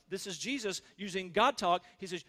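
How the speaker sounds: ordinary talk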